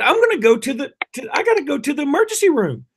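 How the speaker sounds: high, mock-pained dad voice. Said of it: "an excruciating pain".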